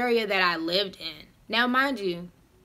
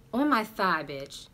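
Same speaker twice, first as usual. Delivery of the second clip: usual speaking voice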